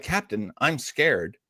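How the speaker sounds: ordinary delivery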